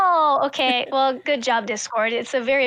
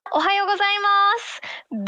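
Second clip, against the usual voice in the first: higher, polite customer service voice; Japanese-speaking voice; 6 semitones higher than usual. Shown as a demonstration. Showing friends their voice in Japanese, high customer service voice. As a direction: lift it into a high Japanese greeting voice. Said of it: "That is my voice in Japanese".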